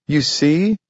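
high, eager little cry. A narrator's reading. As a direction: call it out in delight. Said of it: "cried Ebenezer".

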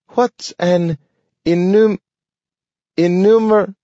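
trying and failing to say a hard word; dog stumbling on a word. A narrator's reading. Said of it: "lots of words that I can't say".

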